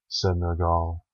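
plain reading voice

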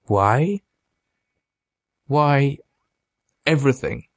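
understated tearful questioning; sobbed. A narrator's reading. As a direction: plead as if close to tears. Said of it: "Mitch sobbed".

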